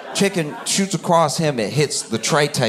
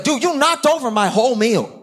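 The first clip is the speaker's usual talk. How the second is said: angry complaint; 10 semitones higher than usual. Retold or imitated.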